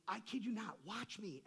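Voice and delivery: ordinary talk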